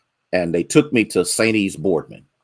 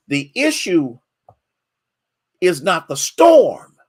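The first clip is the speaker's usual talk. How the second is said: preaching climax; 7 semitones higher, 7 dB louder, slower than usual. Acted. Sermon climax line.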